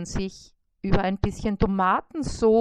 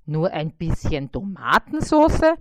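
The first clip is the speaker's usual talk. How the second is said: louder, puzzled question in the fly voice; disbelieving fly; 3 semitones lower, 7 dB louder than usual. A narrator's reading. Fly repeating the spider's order, puzzled and louder. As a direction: echo it back, baffled. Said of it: "Bissi repeats in disbelief".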